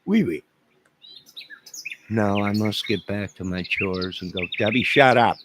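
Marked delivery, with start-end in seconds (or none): 0.1-0.4 squeaky high hammed-up French "oui, oui"; high mock French chirp. 4.6-5.4 loud, higher mock snap at Debbie; playful shout at the commenter.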